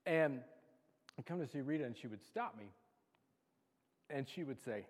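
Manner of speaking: usual speaking voice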